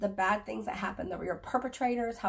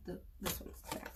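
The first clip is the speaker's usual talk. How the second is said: muttered aside; 2 semitones lower, 12 dB quieter, faster than usual. Real, not acted.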